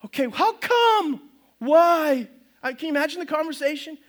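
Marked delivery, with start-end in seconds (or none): 0.1-2.3 high, slow bewildered questions; puzzled Noah. Acted. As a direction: Noah needing a reason, baffled. Noah voiced questioning God.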